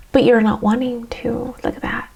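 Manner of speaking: ordinary talk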